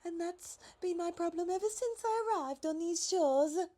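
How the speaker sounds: high, light giraffe voice, politely worried; high fretful giraffe voice. A narrator's reading. Giraffe character voice.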